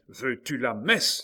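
loud, drawn-out threatening demand; furious cry. A narrator's reading. Enraged king to Henri with a fatal weapon, furious cry. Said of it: "s'écria-t-il".

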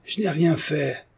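high, drawn-out cry of protest; exclaiming. A narrator's reading. Hélène denies the charge to the mob, crying out. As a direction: cry out innocence, high and slow. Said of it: "s'écria Hélène".

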